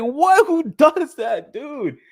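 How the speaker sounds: high, loud, drawn-out mock outrage; losing his mind. Son hearing posters are in the trash, losing his mind. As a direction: reenact an incredulous, stretched-out cry. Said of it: "I fucking lost my mind".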